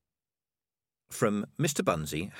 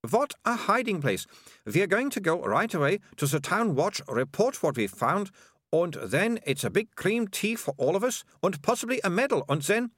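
put-on high, quick excited young girl; eager girl; 9 semitones higher than usual. Melissa plans a cream tea and medal, getting carried away. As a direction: rush ahead with your grand plans.

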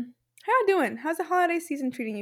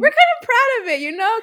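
excited; 9 semitones higher, 6 dB louder, faster than usual. Real, not acted. Giddy pride.

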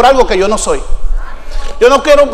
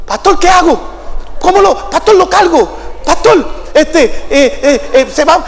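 higher-pitched, stammering helper in a fluster; flustered Alfredo; 4 semitones higher than usual. Retold or imitated. Alfredo helpless before his crying pastor, imitated flustered and stammering. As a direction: imitate someone frantically unsure what to do. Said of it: "Y Alfredo me decía".

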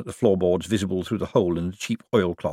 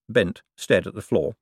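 usual speaking voice

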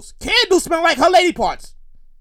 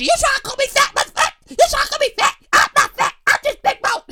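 high-pitched indignant protest; hammed-up, much higher defensive voice; mock offended recipient; 12 semitones higher, faster than usual. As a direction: squeal it like someone taking offense. Acts out offended recipient starting confrontation.

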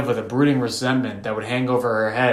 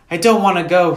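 put-on high, loud protesting child; cried out; 7 semitones higher, 8 dB louder than usual. A narrator's reading. Little sister refusing to climb down the ladder, cried out. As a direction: cry out in refusal, pitched up. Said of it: "she cried".

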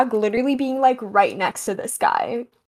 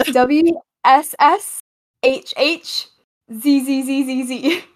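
spelling it out; 3 semitones higher than usual. Shown as a demonstration. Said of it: "spell that one more time".

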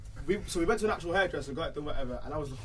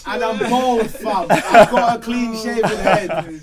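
laughing; 4 semitones higher, 11 dB louder, slower than usual. Real, not acted. Laughing while telling story.

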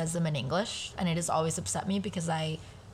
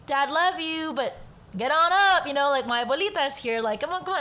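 playful nagging, pitched higher; joking shoo; 7 semitones higher, 4 dB louder than usual. Retold or imitated. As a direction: tease dad upstairs with a light push. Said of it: "I would shoo him jokingly".

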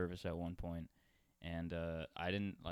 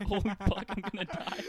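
much higher, loud panicked cry in a changed voice; mock panic; 12 semitones higher, 6 dB louder than usual. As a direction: play up the terror of being sick. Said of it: "I was just like".

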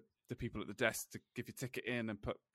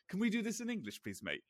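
imitated Scouse, pitched high then dropping on "mate"; put-on Scouse lilt; thick Liverpudlian accent; 9 semitones higher than usual. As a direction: do a hammed-up Liverpool bloke. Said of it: "in the thickest Liverpudlian accent ever".

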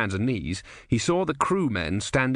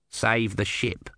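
slow, plain demand; firm small god; slower than usual. A narrator's reading. Om asking the Sea Queen to spare the ship, firm and slow. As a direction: state it flatly, no pleading.

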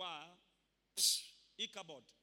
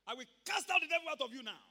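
loud, high, furious outburst; angry shout; 10 semitones higher, 13 dB louder than usual. Retold or imitated. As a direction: snap at the stranger who stepped on him.

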